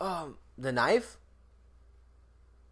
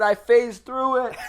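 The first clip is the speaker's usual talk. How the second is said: laughing hard while talking; cracking up mid-sentence; 7 semitones higher, 6 dB louder, slower than usual. Real, not acted. Laughing at toilet story.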